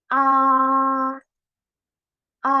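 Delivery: level high A held flat; first tone A. Shown as a demonstration. Teacher demonstrating tone one, high and level. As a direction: hold A high and flat, no change. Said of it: "Seperti apa bunyinya?".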